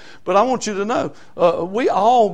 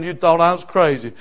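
ordinary talk

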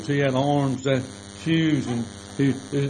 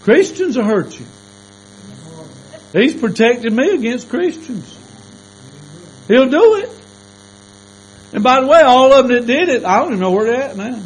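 higher, louder preaching build; fervent preaching; 9 semitones higher, 8 dB louder than usual. Acted. Preacher warning the congregation, rising fervent. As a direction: drive the point home.

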